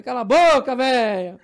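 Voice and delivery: loud, high shout back; retold angry holler; acted heckler from the back. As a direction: yell it like an anonymous passenger. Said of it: "Fingindo que era outro lá de trás".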